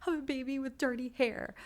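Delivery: high, frantic mock panic over the hair; half-joking panicked squeal; retold panic. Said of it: "all I could think of was".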